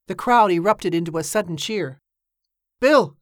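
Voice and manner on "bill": sharp high shout of a name; shouted call. A narrator's reading. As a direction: call out loudly to a mate. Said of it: "Danny shouted".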